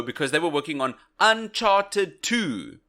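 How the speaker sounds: usual speaking voice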